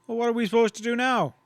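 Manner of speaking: high, fast, pushy demand; a cowhand demanding what comes next. A narrator's reading. Cowhand pressing his boss for orders, demanding. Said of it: "one of the men demanded".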